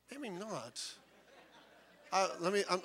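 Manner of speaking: ordinary talk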